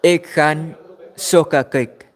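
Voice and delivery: Ek gaan sokker kyk said slowly and clearly; teaching Afrikaans pace; slow Afrikaans example. Shown as a demonstration. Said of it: "let me give another example".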